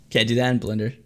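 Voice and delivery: normal talking voice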